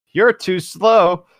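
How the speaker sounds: slow smug villain line; cocky taunt. Acted. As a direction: savour every word of the taunt. Jeff taunting attackers.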